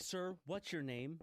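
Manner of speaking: airline assistant. Retold or imitated. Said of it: "the airline assistant comes like".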